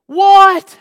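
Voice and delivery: loud, high yell; aggravated. Acted. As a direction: yell back in exasperation. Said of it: "He's done got him aggravated".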